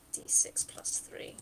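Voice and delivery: whispering the numbers to self; whispered math. Real, not acted. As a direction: count it under your breath. Muttering the dice sum.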